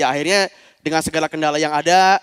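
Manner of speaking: ordinary talk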